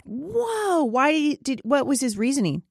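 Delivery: real surprise, high drawn-out "Whoa"; shocked disbelief. Real, not acted. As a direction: react high-pitched, taken aback by the news. Reacting to superintendent's mask stance.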